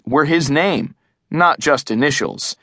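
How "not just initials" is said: ordinary delivery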